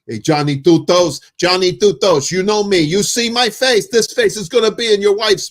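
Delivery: put-on mild Long Island wiseguy New York accent. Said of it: "I kinda know the cadence of those people".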